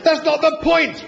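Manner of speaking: protesting. A narrator's reading. Arthur arguing with Zaphod, high indignant protest. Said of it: "Arthur protested".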